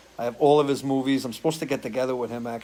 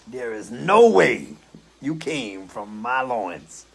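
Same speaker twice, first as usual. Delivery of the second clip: slow, drawn-out character voice, the start louder; impersonation; 4 dB louder, much slower than usual. Pretend. Telling chat he loves impersonators, then doing a slow put-on movie voice. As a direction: deliver it like a disgusted movie father, slow and heavy. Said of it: "I love impersonators".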